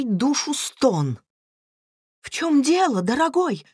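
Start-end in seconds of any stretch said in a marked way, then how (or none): 2.2-3.6 high, anxious wifely question; acted concern, pitch raised; alarmed. A narrator's reading. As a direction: ask your husband with sudden worry. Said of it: "встревожилась Люси".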